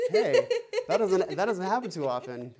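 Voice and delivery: high laughing exclamation. Real, not acted. Drum teacher laughing at finding something new to practice. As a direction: laugh it out, surprised at yourself.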